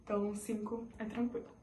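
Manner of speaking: ordinary talk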